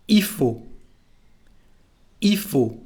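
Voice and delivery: "Il faut" said ee-fo, L dropped; spoken French contraction. Shown as a demonstration. Teacher modelling il faut for learners, said as ee-fo. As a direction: say "Il faut" with no L sound. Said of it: "when we speak, we just say i".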